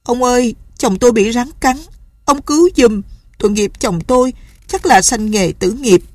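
a wife weeping as she begs the healer; sobbing plea. Acted. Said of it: "ông nghe tiếng khóc nức nở".

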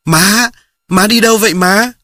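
put-on high, loud cry of a boy to his mother; little brother shouting to his mother at the well, high and loud; child calling out. A narrator's reading. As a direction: call out to Mom across the yard, high and loud. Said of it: "rồi nó la lên".

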